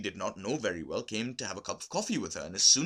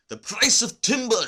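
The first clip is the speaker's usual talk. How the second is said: much higher, louder, eager business talk; 13 semitones higher, 11 dB louder than usual. A narrator's reading. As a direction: announce it like big news to customers. Olga talks business eagerly.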